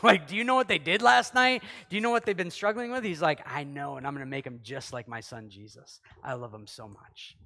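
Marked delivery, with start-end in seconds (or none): 0.3-3.0 louder, higher, fast indignant tattling; indignant accusation. Pretend. Accusing voice listing faults. 3.5-7.4 low, soft loving reply; calm them with quiet love; tender. Pretend. God answers tenderly.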